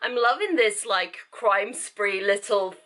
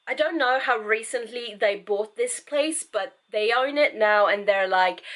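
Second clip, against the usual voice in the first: ordinary talk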